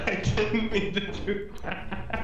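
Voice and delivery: slow, giggling apology; laughing. Real, not acted. Laughing after accident.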